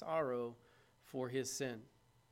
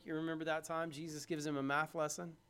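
ordinary talk

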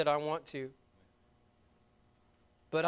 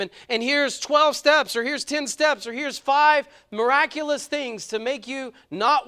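higher, louder, pressing preacher voice; full-force preaching; 6 semitones higher, 7 dB louder than usual. Acted. Preacher pleading with the congregation, higher and louder. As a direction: drive the point home from the pulpit.

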